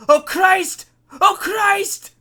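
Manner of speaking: high, very loud cries of fear; panicked screaming. A narrator's reading. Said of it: "constantly screaming over and over".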